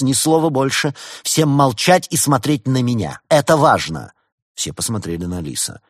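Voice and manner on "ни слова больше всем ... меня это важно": high, loud commanding order; 1 firm command. A narrator's reading. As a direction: take charge of the room. Улис командует всем. (Улис громко и твёрдо приказывает всем)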